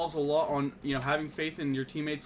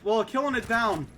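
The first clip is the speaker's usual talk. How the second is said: much higher, loud hype call; hyped shoutcast; 7 semitones higher, 8 dB louder than usual. Real, not acted. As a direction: erupt over the kills like a caster. Caster hypes a double kill.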